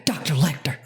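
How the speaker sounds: lightly voiced film-heroine impersonation; Clarice Starling. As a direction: address the doctor with a hint of the character. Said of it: "Clarice Starling impersonation".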